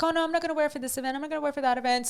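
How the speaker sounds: a hint of a picky little girl; daughter refusing. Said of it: "she was like".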